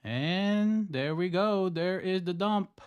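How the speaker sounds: high, loud, slow drawn-out call; excited. Real, not acted. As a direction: savour the drop, stretched out. Watching price drop, excited.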